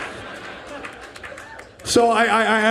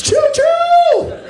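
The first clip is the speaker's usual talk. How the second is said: much higher, louder shrieked choo-choo; train whistle call; 6 dB louder than usual. Retold or imitated. Joking to the ladies, a loud high train whistle. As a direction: blast it like a steam whistle.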